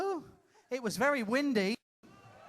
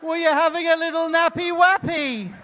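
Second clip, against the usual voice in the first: put-on high, loud cooing as if to a toddler; mock-coddling baby talk; 8 semitones higher, 9 dB louder than usual. Comic to a sleeper in the audience, mock coddling. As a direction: coo it with teasing concern. Teasing an audience member found asleep.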